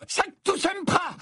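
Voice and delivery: high, stretched-out incantation cry; yelled spell. A narrator's reading. Harry falling, casting at his attacker, yelling. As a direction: hurl the spell out at high pitch. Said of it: "hurla Harry".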